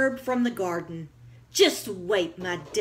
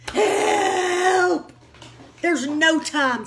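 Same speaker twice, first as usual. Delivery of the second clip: higher, loud cry for help; panicked shout; 9 semitones higher, 6 dB louder, much slower than usual. Acted. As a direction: shout in alarm. Panic over Max in soup.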